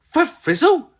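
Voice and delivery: high loud rising question; surprised question. A narrator's reading. Molly asking her mum in surprise, high and loud. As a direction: ask in surprise. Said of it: "asked Molly".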